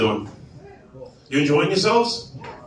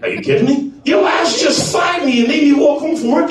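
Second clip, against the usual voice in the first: high-pitched retold rant; comic indignant husband; outraged disbelief; 8 semitones higher than usual. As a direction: throw it back at her, incredulous.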